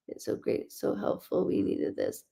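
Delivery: soft whispered relay; whispered message. Real, not acted. Medium softly relaying a grateful message, whispered. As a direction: pass it on in a hush.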